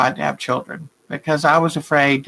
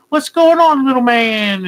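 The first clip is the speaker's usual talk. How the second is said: put-on high, loud, bright greeting to the baby; light up and greet the little one; cheery greeting to baby; 8 semitones higher, 3 dB louder than usual. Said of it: "He's like".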